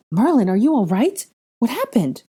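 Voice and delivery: alarmed, high, loud questions; urgent and worried; demanding. Acted. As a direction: demand answers from a shaken friend. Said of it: "Ginny demanded".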